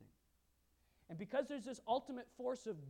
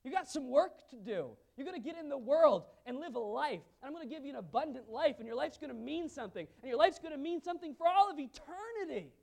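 louder, higher, rousing call to live; Jesus as a passionate coach; 5 semitones higher, 8 dB louder than usual. Retold or imitated. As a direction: fire them up to go and live. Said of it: "Jesus said".

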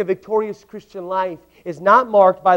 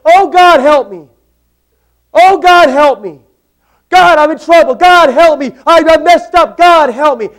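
loud, high pleading cries for help; desperate cry; 8 semitones higher, 13 dB louder than usual. Acted. Peter sinking cries to God, loud desperate pleading. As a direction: beg God like you're drowning.

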